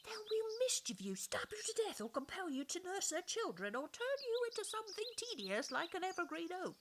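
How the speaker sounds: high, urgent warning. A narrator's reading. Plants warning Mamie about fairies, high urgent voice. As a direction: warn her of the danger. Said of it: "they warned her".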